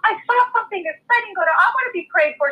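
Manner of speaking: waitress begging to be prayed for, high and excited. Retold or imitated. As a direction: squeal it out like she can't contain herself. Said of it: "She was so excited".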